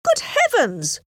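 high-pitched exclamation of disbelief; flabbergasted, high outburst; astonished cry. A narrator's reading. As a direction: cry out aghast, pitch jumping up. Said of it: "cried Mister Elton".

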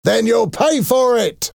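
very high, forceful yell; shouted threat. A narrator's reading. Soldier threatens the defiant rebels, shouting. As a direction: call out the threat high and hard. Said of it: "shouted the soldier".

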